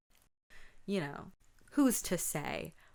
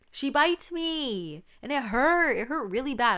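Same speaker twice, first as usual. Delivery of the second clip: higher, louder, slow outraged cry; outraged retelling; 8 semitones higher, 8 dB louder than usual. Real, not acted. Vlogger reliving her sister's bite, higher, louder, outraged.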